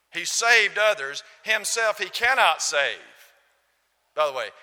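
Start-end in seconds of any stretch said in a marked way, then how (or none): 0.1-3.0 mocking jeer. Mockers at the cross.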